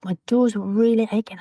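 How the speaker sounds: whispering the ache; whispered. Real, not acted. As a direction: confide it under your breath. Whispered retelling.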